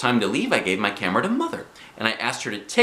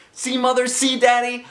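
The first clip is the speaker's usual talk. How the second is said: put-on high-pitched child's call; 14 semitones higher, 4 dB louder than usual. A narrator's reading. Girl in pool to her parents, calling out. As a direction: call out proudly to your parents. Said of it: "I called".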